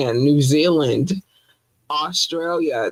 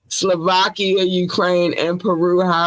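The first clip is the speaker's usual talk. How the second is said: ordinary delivery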